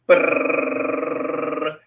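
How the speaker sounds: lips rolled like a snare drum. Acted. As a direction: buzz out a drum roll. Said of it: "drum roll".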